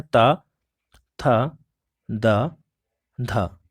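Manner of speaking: ता था दा धा said one by one, tongue on upper teeth; dental consonants recited. Shown as a demonstration. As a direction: sound out ता, था, दा, धा slowly with pauses. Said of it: "इनको आपको याद रखना है". (दंत व्यंजन एक-एक करके बोलना)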